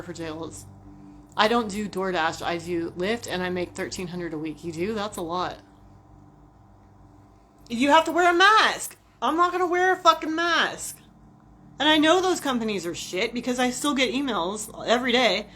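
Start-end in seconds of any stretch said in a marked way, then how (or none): none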